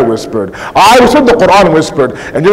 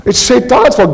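normal talking voice

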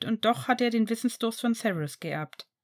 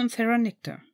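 ordinary delivery

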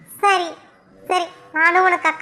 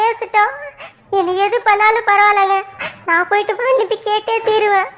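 crying, determined to apologise; tearful remorse. Acted. Wife vows to beg her aunt's forgiveness, crying. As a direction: sob between short phrases, insisting.

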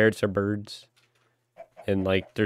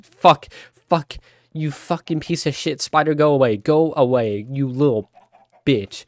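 swearing tirade; 4 semitones higher, 4 dB louder than usual. Real, not acted. Spider attacks, swearing outburst.

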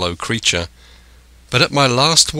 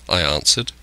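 plain reading voice

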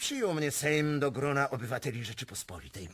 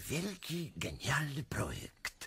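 secretive whisper; 4 semitones higher, 5 dB quieter than usual. Acted. Prince confides a secret plan.

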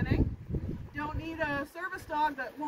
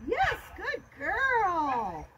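slow, stretched-out happy praise; much slower than usual. Shown as a demonstration. Trainer showing the happy voice to praise the puppy. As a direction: draw it out for her. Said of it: "Happy voice, you guys".

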